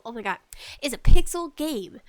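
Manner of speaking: ordinary talk, nothing marked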